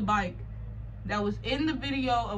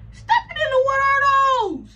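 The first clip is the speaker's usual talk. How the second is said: outraged yell; 8 dB louder than usual. Real, not acted. Telling viewers a stranger wore her shoes, outraged high yell. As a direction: wail it out in disbelief, voice cracking high.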